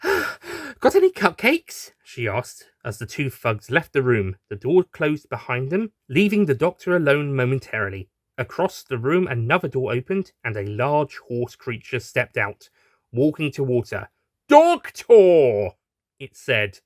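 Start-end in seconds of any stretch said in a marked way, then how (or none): 0.0-1.9 very high, slow, playful voice; high slow doctor. Acted. Tied-up doctor teasing the thugs, high and slow. 14.5-15.7 loud booming call. Acted. Horse creature hailing the doctor, loud boom. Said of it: "in a deep, booming voice".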